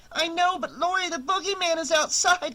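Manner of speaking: put-on high, urgent child's voice; a frightened boy insisting she look; scared little boy. A narrator's reading. Tommy's child voice.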